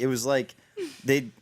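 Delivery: ordinary delivery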